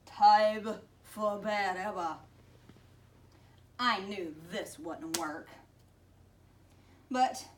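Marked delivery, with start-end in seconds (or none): 0.1-2.2 sniffling monster. A narrator's reading. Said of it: "sniffled through noses".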